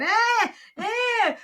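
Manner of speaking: high, loud mock infant bleats; short wailing baby noises, imitated; imitated baby cry. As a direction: mimic a hungry baby fussing. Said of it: "He's trying to feed".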